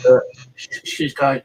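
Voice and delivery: ordinary delivery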